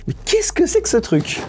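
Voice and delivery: high-pitched puzzled viewer; mock bafflement. Viewers asking about the mystery shoe, mock baffled.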